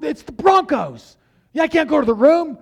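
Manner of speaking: high, loud indignant protest. Retold or imitated. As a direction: argue back in disbelief. Brother protesting to dad.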